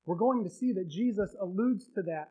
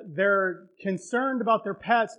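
ordinary talk, nothing marked

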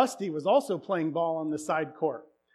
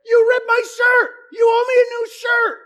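much higher, shouting schoolboy outrage; kid yelling accusation; 13 dB louder than usual. Retold or imitated. Rusty yelling at Jake over ripped shirt, furious shout. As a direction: yell it like an indignant kid. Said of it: "He goes".

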